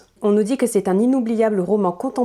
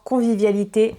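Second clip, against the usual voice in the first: ordinary talk, nothing marked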